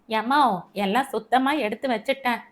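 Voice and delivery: usual speaking voice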